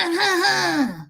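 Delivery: high, drawn-out eerie wail; spooky wordless sound to scare someone; creepy noise. Acted. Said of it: "scaring the shit out of somebody".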